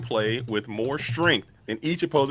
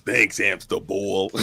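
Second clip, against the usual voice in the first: slow, drawn-out put-on guy voice; New Jersey guy; 4 semitones lower, much slower than usual. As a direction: play the Jersey guy, dragging each word. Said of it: "that's like my guy".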